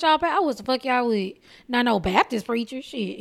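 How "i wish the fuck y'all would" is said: ordinary talk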